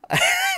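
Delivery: high-pitched laugh bursting out; high burst of laughter. Real, not acted. Laughing at own joke.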